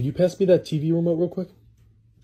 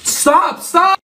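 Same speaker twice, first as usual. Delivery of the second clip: shouting; 8 dB louder than usual. Real, not acted. Protesting the makeover.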